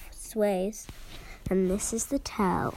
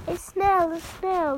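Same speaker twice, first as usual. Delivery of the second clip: startled shriek; 8 semitones higher than usual. A narrator's reading. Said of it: "the teacher turned pale".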